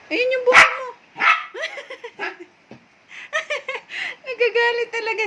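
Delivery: cracking up at the grumpy dog; laughing while talking. Real, not acted.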